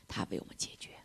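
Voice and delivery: soft whispered close; hushed reassurance. Real, not acted. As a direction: breathe it out quietly. Preacher whispers the resolution.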